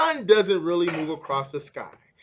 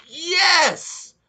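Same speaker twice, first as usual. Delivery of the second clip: eager yes; 13 semitones higher, 6 dB louder than usual. Acted. Teacher confirms Dion's idea.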